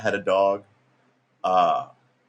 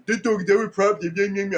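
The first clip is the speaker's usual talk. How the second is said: squeaky high put-on voice, half joking; mock high shelter voice; 12 semitones higher, 3 dB louder than usual. Storyteller mimics shelter staff in a silly high voice.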